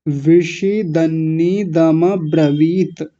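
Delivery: विषि दन्नी दम ब्रवीत slowly, piece by piece; broken in four parts. Shown as a demonstration. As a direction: lengthen each part of विषि दन्नी दम ब्रवीत. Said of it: "चार जगह तोड़ लीजिए". (विषि दन्नी दम ब्रवीत धीरे, टुकड़ों में)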